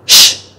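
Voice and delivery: "sh" as a long hushing sound; big S. Shown as a demonstration. Teacher introducing the big S, a drawn-out SH. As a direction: hush "sh" with no voice. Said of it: "Nó là âm S lớn".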